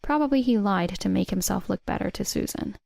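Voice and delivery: plain reading voice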